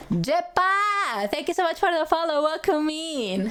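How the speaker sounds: high, quick bright thank-you; cheery thanks. Real, not acted. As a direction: streamer thanking a new follower, high and cheery. Thanking a new follower.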